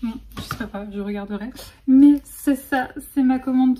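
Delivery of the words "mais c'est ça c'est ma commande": slow, much louder delighted exclamation; excited. Real, not acted. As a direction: burst out with joy at the parcel. Excited reveal of the parcel. (ravie et enthousiaste)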